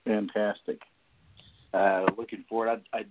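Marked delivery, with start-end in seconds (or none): none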